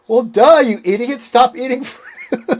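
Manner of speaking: high, loud mock telling-off; mock exasperated scolding. Pacer telling runner to quit fruit, mock exasperated. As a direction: scold a friend for the obvious, laughter creeping in.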